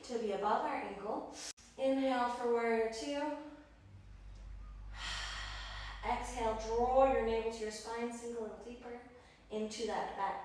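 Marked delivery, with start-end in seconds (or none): 3.9-6.1 long, soft audible breath; demonstrated deep breath. Shown as a demonstration. Yoga teacher leading breathing in warrior two, deep breath. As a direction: breathe fully for others to follow.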